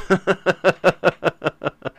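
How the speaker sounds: long, soft staccato laugh; real laugh. Real, not acted. Host reading a chat message, real soft laugh. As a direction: laugh softly in a long run of pulses.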